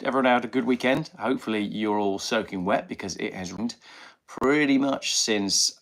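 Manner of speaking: ordinary talk, nothing marked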